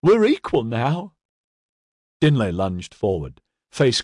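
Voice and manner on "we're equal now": high, drawn-out demented sing-song; unhinged, crowing chant; peculiar sing-song. A narrator's reading. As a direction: lilt it far up high, slow and gloating. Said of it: "in a peculiar sing-song voice".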